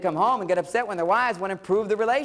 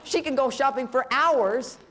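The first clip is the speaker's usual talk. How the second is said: pitched up through laughter; laughing; 7 semitones higher than usual. Real, not acted. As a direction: laugh as you tell it. Laughing about wife's shopping.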